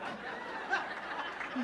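airy unvoiced laugh; breathy laugh. Real, not acted. Speaker laughing at the surprise, breathy. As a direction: let a soft disbelieving laugh out.

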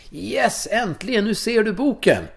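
high, elated cry of relief at last; inner joyful shout. Retold or imitated. Twelve-year-old boy's inner cry on finding the book, elated. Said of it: "jag bara skrek".

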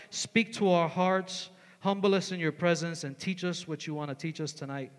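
preacher praying over the youth, soft and reverent; prayer. Real, not acted. Closing prayer.

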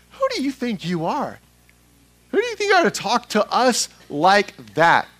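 high, indignant comeback to an upstart; affronted challenge. Acted. Crowd's affront at Jesus, acted out.

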